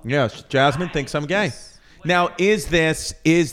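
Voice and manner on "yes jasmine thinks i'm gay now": ordinary talk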